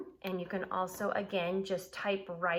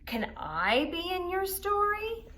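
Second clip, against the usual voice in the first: Narwhal asking; 11 semitones higher, 4 dB louder, slower than usual. A narrator's reading. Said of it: "said Narwhal".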